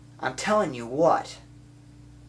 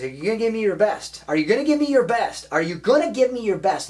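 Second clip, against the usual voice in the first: raised, insistent coach questioning; fired-up coach, pitched high and pushing; demanding coach; 7 semitones higher, 4 dB louder than usual. Retold or imitated. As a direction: press the player hard for a commitment. Acting out coach pressing player.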